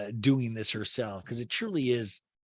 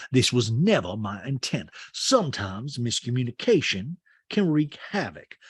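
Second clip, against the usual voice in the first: Sutton being serious; 3 semitones lower than usual. Retold or imitated. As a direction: read a message out with mock solemnity. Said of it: "it's serious when Sutton puts on glasses".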